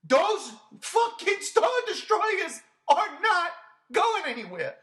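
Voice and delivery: slow, high, furious outburst; angry rant. Real, not acted. Ranting to his co-host, slow and furious. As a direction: rail at the film, dragging it out. Ranting about the Star Destroyers.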